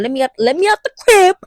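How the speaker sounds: acted-out desperate insistence; mock pleading demand. Acting out pleading to leave.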